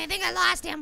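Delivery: ordinary delivery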